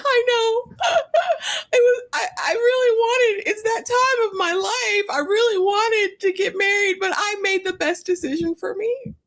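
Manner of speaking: high wailing mock sob; mock crying. Host imitating Elise crying on the plane, high mock sob. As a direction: wail it on the plane. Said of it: "she's on the plane crying".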